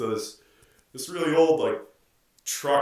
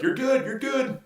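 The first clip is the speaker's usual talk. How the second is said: imitated yelling; 14 semitones higher than usual. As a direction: yell it like a gas attendant directing a driver. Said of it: "he kept yelling at her".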